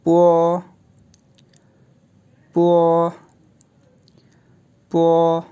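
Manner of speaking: पो said as a crisp Chinese bo, lips together; पो, clean and rounded, with the vowel held; पो like the Mandarin syllable bo; pinyin bo. Shown as a demonstration. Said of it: "तो sound देता है जी ये".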